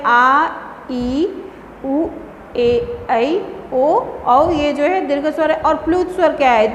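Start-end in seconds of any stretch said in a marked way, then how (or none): 0.0-4.5 separate, slightly held vowel sounds; teacher's demonstration of long vowels. Shown as a demonstration. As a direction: recite आ, ई, उ one at a time, lengthened. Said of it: "दो गुना अधिक समय लगता है".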